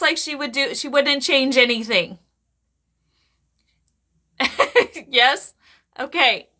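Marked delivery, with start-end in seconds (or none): 4.4-5.0 short high giggle; brief tickled laugh; amused chuckle. Real, not acted. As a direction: let out a quick giggle. Amused during the reading.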